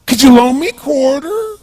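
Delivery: put-on a drunk beggar asking for a quarter in a strange high voice; bizarre voice. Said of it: "He had this really bizarre looking sounding voice".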